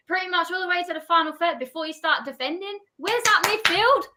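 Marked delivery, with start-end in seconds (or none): 3.0-4.0 high, slow, angry demand at the midfield; exasperated yell. Real, not acted. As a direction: shout it at the pitch in frustration. Exasperated fan shouting at team.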